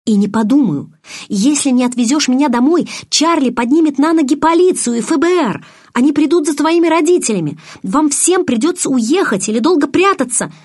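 высоко, громко, с напором; угрожай, всё больше распаляясь; гневная угроза. A narrator's reading. Героиня угрожает Эдварду. (гневно угрожая)

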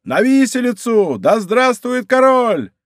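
high, drawn-out rallying cries; crowd shouts. A narrator's reading. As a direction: cry out over the crowd. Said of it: "смешивались с криками".

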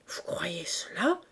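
slow, whispery indignant question; stifled fury. A narrator's reading. Aunt shocked by gossip about Gavard, stifled fury. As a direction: choke the question out slowly under the breath. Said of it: "s'écria madame Lecœur, blême de fureur".

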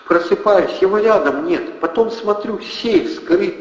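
high, tearful voice breaking. A narrator's reading. Anna telling the gang about the safe, tearful. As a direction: speak through rising tears. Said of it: "плачущим, срывающимся голосом".

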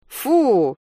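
high exclaimed yuck; cry of disgust. A narrator's reading. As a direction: cry out in mild revulsion. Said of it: "вскрикнула Алиса".

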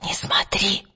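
urgent hushed whisper. A narrator's reading. Girl warning her friend not to look, whispered. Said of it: "шепнула она".

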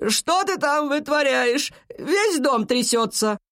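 high, slow scolding call; energetic granny calling out, stretched and high; grandmother shouting. A narrator's reading. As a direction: holler a complaint up from downstairs. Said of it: "кричала снизу его бабушка".

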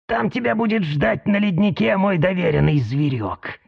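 quiet creaking instructions; creaky voice. Acted. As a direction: creak it out softly. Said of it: "проскрипел кому-то Аяй".

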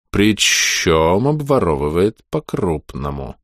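slow eerie hissing mage; strange whistling voice. Acted. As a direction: draw out each word, sinister and sibilant. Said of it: "странным свистящим голосом".

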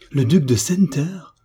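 high, astonished echo of the name; stupefied exclamation. A narrator's reading. Friend learns who the suitor really is, stupefied exclamation. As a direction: repeat it as a shocked, rising question. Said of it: "frappée de stupeur et d'effroi".

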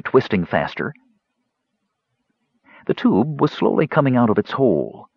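plain reading voice

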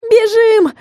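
very high urgent cry; high-pitched acted yelp; squeal. A narrator's reading. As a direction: squeal the order to flee. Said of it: "взвизгнула я".

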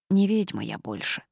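plain reading voice

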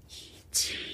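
very soft, voiceless beckoning; eerie whisper. A narrator's reading. Demon luring the doctor, faint whisper. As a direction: barely breathe it out.

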